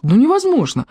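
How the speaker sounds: high-pitched, incredulous protest; disbelief. A narrator's reading. Icking realising he holds the treasures, incredulous. Hero's disbelieving thought, repeated.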